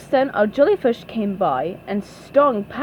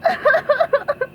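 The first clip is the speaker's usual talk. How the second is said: high cartoon boo-hoo sobs; staccato acted wailing, pitched way up; SpongeBob crying; 3 dB louder than usual. A narrator's reading. SpongeBob is laughed at by everyone, high mock sobbing. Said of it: "SpongeBob started crying".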